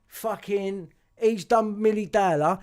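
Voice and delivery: usual speaking voice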